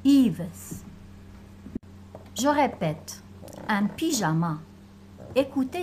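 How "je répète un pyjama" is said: ordinary talk, nothing marked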